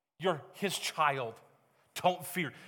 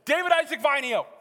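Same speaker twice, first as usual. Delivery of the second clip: raised, higher full-name summons; scolding mom; 7 semitones higher, 5 dB louder than usual. Retold or imitated. Mom to her son in trouble, scolding by full name. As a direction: imitate mom calling out a child in trouble. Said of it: "I knew I was in trouble when my mom said my full name".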